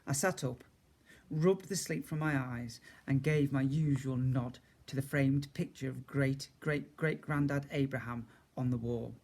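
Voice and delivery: usual speaking voice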